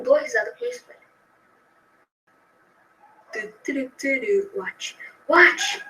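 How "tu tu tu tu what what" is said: normal talking voice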